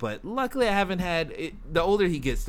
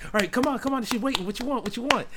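high-pitched, hurried pestering; mock impatient nagging; impatient dad; 10 semitones higher, faster than usual. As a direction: imitate a dad rushing someone to order. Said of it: "he just doesn't have any patience".

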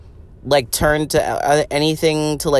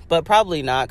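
ordinary talk, nothing marked

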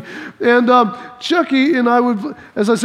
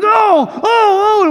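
high yelps of being stung; mock pain yelps; 9 semitones higher, 3 dB louder, much slower than usual. Boy getting stung while wrestling, mock pained yelps. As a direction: yelp as each sting lands.

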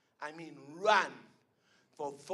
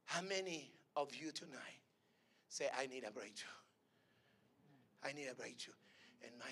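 low, much softer prayer voice; 4 semitones lower, 13 dB quieter than usual. Acted. Preacher invites the sick forward, low and soft. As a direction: draw them gently to the altar. Quiet altar call and prayer.

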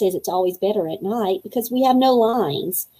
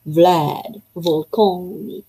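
"Vlad Volconi" drawn out slow and lower in a vampire accent; slow, lower Transylvanian vampire accent on "Vlad Volconi"; 3 semitones lower, much slower than usual. Shown as a demonstration. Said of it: "if you say it with my accent".

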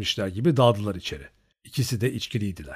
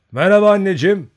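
loud, high call to his mother across the yard; greeting his mother with a loud, high call; 9 semitones higher, 11 dB louder than usual. A narrator's reading. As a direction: call out to her from the steps. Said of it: "diye seslendi".

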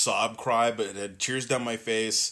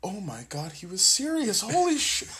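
stunned disbelief; 10 semitones higher than usual. Retold or imitated. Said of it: "she's just sitting there with this whole, like".

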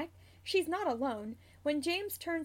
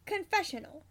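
ordinary delivery, nothing marked

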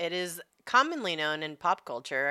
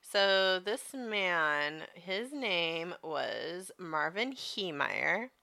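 slow dramatic telling; much slower than usual. Acted. Dramatic story opening.

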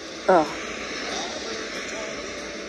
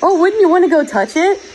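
mock teasing question; 8 semitones higher than usual. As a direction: mimic the teaser, egging him on. Mimicking a teaser's question.

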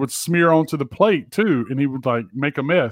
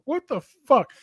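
incredulous; 10 semitones higher than usual. Real, not acted. Mocking disbelief.

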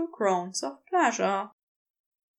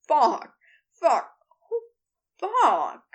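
keening; 3 semitones higher, 4 dB louder, slower than usual. A narrator's reading. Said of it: "Crowley keened and pushed himself forward".

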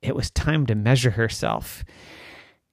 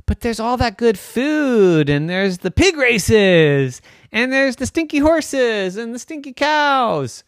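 high, enthusiastic mom's voice; excited coaxing; 12 semitones higher, 5 dB louder than usual. Acted. Mommy coaxes Daphne.

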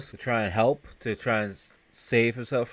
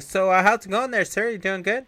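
high, loud, mock-friendly nerd voice; nerdy guy; 10 semitones higher, 6 dB louder than usual. Bow-tied driver greeting a tough guy, high nerdy voice. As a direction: greet him as a hammed-up nerd. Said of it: "just looking like a nerd".

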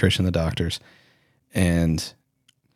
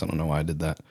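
normal talking voice

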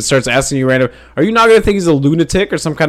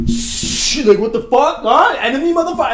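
hostile ancient locals; 10 semitones higher than usual. Pretend. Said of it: "turn around like".

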